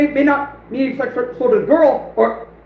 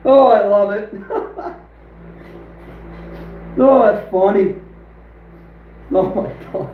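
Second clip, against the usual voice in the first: laughing; 3 semitones lower than usual. Real, not acted. Laughing after the ban.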